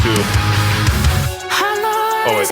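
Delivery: normal talking voice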